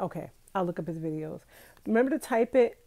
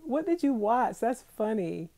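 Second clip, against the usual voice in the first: ordinary talk, nothing marked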